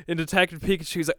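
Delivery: usual speaking voice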